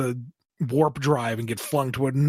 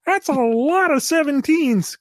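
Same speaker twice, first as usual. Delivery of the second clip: high, slow goofy voice; silly aside voice; 7 dB louder, slower than usual. Acted. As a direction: pop in as a different goofy voice. Aside voice commenting on own story.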